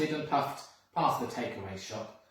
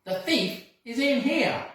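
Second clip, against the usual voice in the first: high, loud excited call; eager alarm call; excited bark; 10 semitones higher, 7 dB louder than usual. Acted. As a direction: announce it like a triumphant dog. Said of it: "the bark meant".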